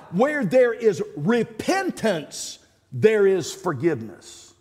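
ordinary talk

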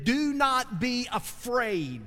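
drawn-out, high reassuring command; slow emphatic command. Acted. Preacher voicing the angel to the shepherds, slow and emphatic. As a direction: stretch each word out and lean on it. Angel's message quoted to congregation.

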